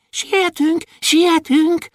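very high little dwarf voice; thin shouted reply. A narrator's reading. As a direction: pipe it back high and thin. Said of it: "Kiabálta vissza Kökölyszi vékonyan". (vékony, magas hangú visszakiáltás)

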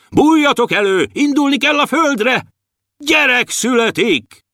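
high, urgent calling down; shouting from a tower. A narrator's reading. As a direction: shout the summons high and far. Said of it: "Kiabálta a fejedelem is a toronyból". (magas, sürgető kiáltás a toronyból)

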